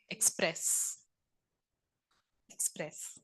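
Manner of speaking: whispered gentle urging; soft whisper. Real, not acted. Teacher softly urging students to let their feelings out. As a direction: breathe it out softly, encouragingly.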